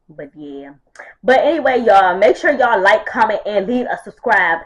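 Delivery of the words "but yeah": whisper. Real, not acted.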